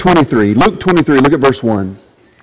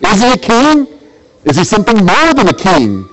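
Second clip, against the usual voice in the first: a hint of a puzzled Pilate, higher; Pilate wondering; 6 semitones higher than usual. Preacher voicing Pilate puzzling over Jesus, lightly higher. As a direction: let Pilate wonder aloud, lightly voiced. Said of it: "He says, as he sees Jesus".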